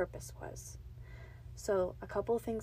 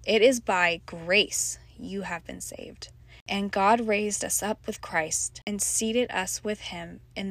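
earnest, louder Bible reading; scripture reading; 6 dB louder than usual. Acted. Reads Ephesians aloud.

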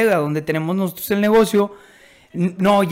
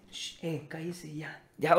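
hushed, much softer shushing; shushing quietly; 4 semitones lower, 15 dB quieter, slower than usual. Retold or imitated. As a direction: hush someone who's too excited. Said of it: "me dice". (chitón en voz muy baja)

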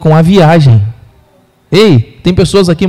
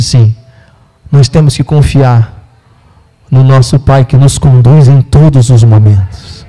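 solemn; 7 semitones lower, slower than usual. Real, not acted. Pastor's solemn conclusion.

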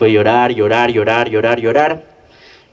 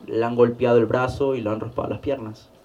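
ordinary talk

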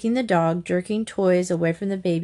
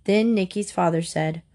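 plain reading voice

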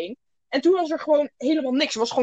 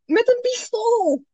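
incredulous; 8 semitones higher, 4 dB louder than usual. Real, not acted. Incredulous high answer.

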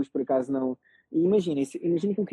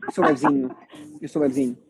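normal talking voice

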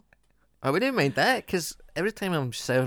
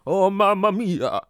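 slow, high, loud mock Italian; supposedly accurate Italian accent; 7 semitones higher, 7 dB louder than usual. Shown as a demonstration. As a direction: stretch out a hammy Italian exclamation. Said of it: "But if you go ... that's more, that's better".

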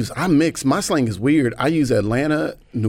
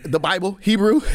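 mom quoted emphatic; 8 semitones higher than usual. Retold or imitated. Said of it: "she was like".

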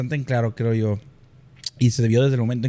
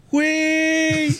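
shocked drawn-out güey; high astonished cry. Real, not acted. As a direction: gasp it out amazed. Shocked exclamation.

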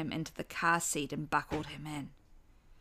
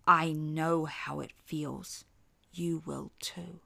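cold slow threat; slower than usual. A narrator's reading.

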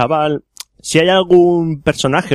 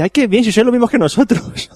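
high delighted foreigner voice; 10 semitones higher than usual. Retold or imitated. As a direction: say it like a pleased tourist. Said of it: "Y dice".

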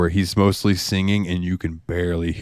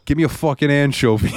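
higher, demanding New York penguin; hammed-up New York accent; thick New York accent; 7 semitones higher than usual. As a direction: demand it like a pushy New Yorker. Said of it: "a thick New York accent".

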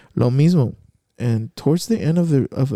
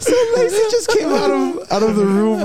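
very high, squeaky words through laughter; laughing while telling; 4 dB louder than usual. Real, not acted. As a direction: try to explain through giggles. Laughing at scared friend.

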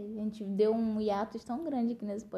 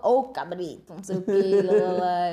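calling out loudly; 10 dB louder than usual. Retold or imitated.

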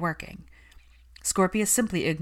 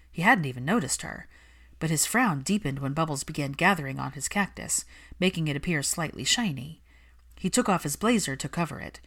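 ordinary delivery, nothing marked

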